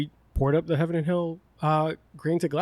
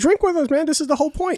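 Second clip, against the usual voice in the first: mock insistent urging; 12 semitones higher than usual. Retold urging staff to drink.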